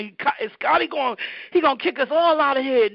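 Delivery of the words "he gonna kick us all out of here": ordinary delivery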